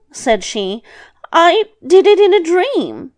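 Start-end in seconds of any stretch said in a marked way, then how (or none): 1.3-3.1 high, loud, lilting excuse; grandmother making excuses. Acted. Grandmother excusing the second hair, high-voiced. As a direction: plead innocence in a high voice.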